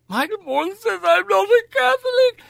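put-on high, loud wailing child voice; hammed-up childish bawling; mock tearful child. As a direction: wail it like a small child running to mother. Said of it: "I went home in floods of tears".

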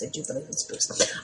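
hushed, eerie whisper; spooky whisper. Retold or imitated. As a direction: whisper it like a ghost story. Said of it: "I sound like the cowbell".

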